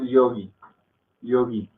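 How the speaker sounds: "yogi" with a subtle, slightly longer final i; Hindi pronunciation. Shown as a demonstration. Teacher showing the subtlety of Hindi, Hindi pronunciation. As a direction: say "yogi" the Hindi way, light emphasis on the i. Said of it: "la sutileza que hay en el hindi".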